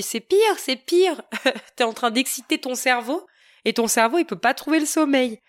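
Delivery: high, emphatic protest; insistent exclamation. Retold or imitated. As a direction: cut him off, it's worse. Insisting to partner about screens.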